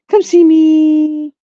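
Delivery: voicing the dog. Owner voicing her puppy calling the friend. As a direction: coax sweetly as the puppy. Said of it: "She'd be like".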